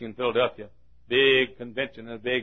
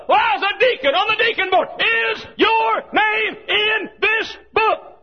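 high-pitched volley climbing to a shout; escalating interrogation; 8 dB louder than usual. Acted. Dives pleading good works as God asks again, escalating holler. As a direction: fire excuse and question back to back, ever higher. Excuses and judge's question escalate.

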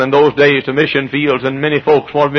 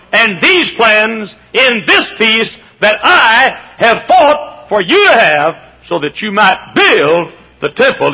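higher voice, building in force; impassioned charge; 9 semitones higher, 5 dB louder than usual. Acted. David charging his son to build the temple, building fervently. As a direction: preach it with mounting urgency.

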